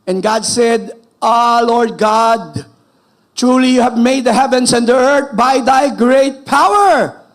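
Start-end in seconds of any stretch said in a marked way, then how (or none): 1.2-7.2 loud, higher, slow proclamation; proclaiming loudly. Acted. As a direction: cry it out like a prophet. Preacher proclaims scripture prayer. (malakas at mabagal na pagpapahayag)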